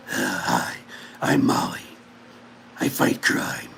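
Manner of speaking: low whispered superhero voice; Molly's Batman voice. Pretend. Voicing the dog as a crimefighter: low whispered Batman growl. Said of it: "That's how she sounds".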